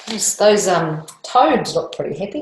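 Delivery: plain reading voice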